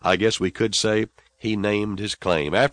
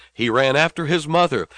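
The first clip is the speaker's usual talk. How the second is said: ordinary talk, nothing marked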